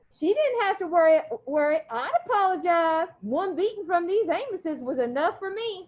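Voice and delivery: steady read-aloud voice for the class; story read aloud. A narrator's reading. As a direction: read the chapter to the students. Teacher reads novel aloud.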